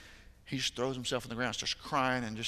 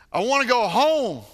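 put-on higher, louder anguished child's cry; mock sobbing wail, higher and louder; imitating a sobbing boy; 11 semitones higher, 13 dB louder than usual. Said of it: "with how hard he's crying".

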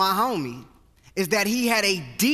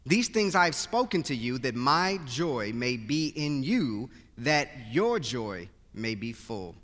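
measured, solemn Bible reading, settling lower at line ends; scripture reading; 6 semitones lower, 4 dB quieter than usual. Acted. Reads scripture before sermon.

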